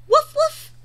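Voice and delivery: high, loud playful barking; puppy bark. Acted. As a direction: bark like an excited little puppy. Voicing the puppy Biscuit.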